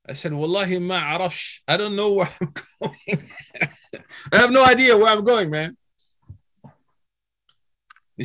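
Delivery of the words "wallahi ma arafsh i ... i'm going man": exasperated. Retold or imitated. Stranded traveller answers the driver, high and helpless. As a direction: throw up your hands, lost and overwhelmed.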